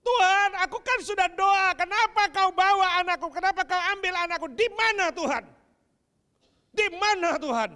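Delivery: loud, high anguished cry at God; bereaved parents crying out at God; grieving anger. Acted. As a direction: hurl the questions upward.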